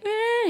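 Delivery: high, loud imitated toddler whine; mock crying wail. Dad imitating his child going downstairs, mock crying. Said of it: "he was, like, crying".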